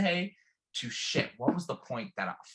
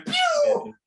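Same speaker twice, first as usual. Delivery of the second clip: very high, sharp pew effect; pew sound effect; 6 dB louder than usual. Retold or imitated. Mimicking the friend rolling off the dune, high pew. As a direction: fire it off like a cartoon zap.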